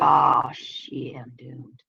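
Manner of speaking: hushed, whispery mock despair; mock doom. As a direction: let it sink away into a soft, doomed murmur. Acting out dread at weight gain.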